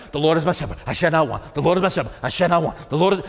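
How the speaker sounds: low, quick mock chanting on repeat; mantra-like recitation. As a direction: imitate people rattling off a verse by rote. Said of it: "like it's a mantra".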